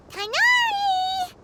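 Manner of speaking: drawn-out high call across open ground. Acted. Paimon searching the desert, calling out for Tighnari. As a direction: stretch the name out slowly to reach him far off.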